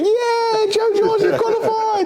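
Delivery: very high, far-off mock cheer of delighted grandparents. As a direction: squeal it out like overjoyed grandparents hearing the news. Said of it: "They're cheering".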